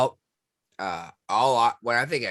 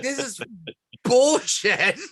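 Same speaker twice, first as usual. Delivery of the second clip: laughing outrage; 12 semitones higher, 4 dB louder, slower than usual. Retold or imitated. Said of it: "was like".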